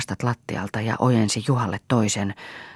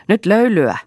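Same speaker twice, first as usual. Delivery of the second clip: high, loud call for more steam; louder, higher shout for steam; 9 semitones higher, 6 dB louder than usual. A narrator's reading. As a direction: shout it across the sauna. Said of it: "huusi Shemeikka".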